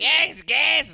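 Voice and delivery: high, loud cartoon kid squeal; Cartman impression. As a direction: squeal it like a bratty cartoon boy. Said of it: "that's my".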